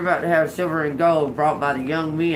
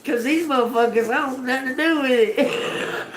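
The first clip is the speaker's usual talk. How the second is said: acted inner voice; 7 semitones higher than usual. Listener talking to God about the crowd, high and laughing. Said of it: "You was like".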